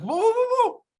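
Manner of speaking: startled warning. Retold or imitated. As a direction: rein someone in fast, like halting a runaway horse. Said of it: "I'm like".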